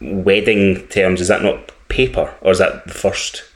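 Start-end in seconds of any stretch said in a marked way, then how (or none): none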